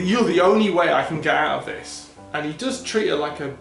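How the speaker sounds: usual speaking voice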